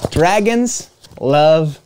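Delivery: plain reading voice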